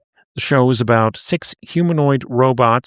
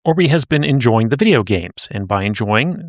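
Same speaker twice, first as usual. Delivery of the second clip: usual speaking voice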